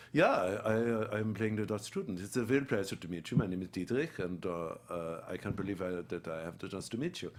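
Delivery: put-on halting greeting in a German accent. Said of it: "we're both gonna do Dutch accents or German accents".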